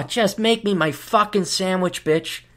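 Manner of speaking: ordinary delivery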